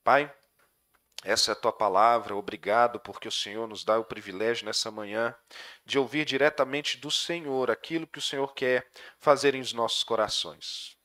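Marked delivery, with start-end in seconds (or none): none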